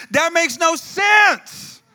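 high, loud, drawn-out protest; incredulous outburst. Acted. Preacher objecting to James's verse, incredulous outburst. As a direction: stretch it out in disbelief.

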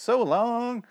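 high, drawn-out so long; sing-song farewell. Pretend. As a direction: sing out the goodbye. Sing-song farewell to Kathy Bates.

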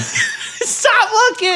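high squeal through laughter, slowed right down; laughing plea. Real, not acted. Host laughing at co-host staring at the fridge, squealing. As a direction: beg him to stop through helpless giggles.

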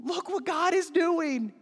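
high, slow, drawn-out wonder; excited. Acted. Two women sharing the news, excited high wonder. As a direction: marvel at it in a high voice. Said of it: "they're just so excited".

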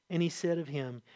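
ordinary talk

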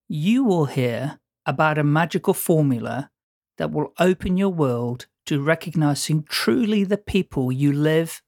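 ordinary talk

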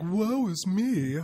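drawn-out, high mock lament; dramatic sigh. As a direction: wail it out slowly like a tragic martyr. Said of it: "Not like".

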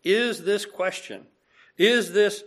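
ordinary talk, nothing marked

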